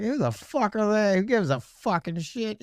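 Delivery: high, indignant retort; high-pitched retold outrage; mock outraged retort. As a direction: scoff at them in disbelief. Angry retort in retold story.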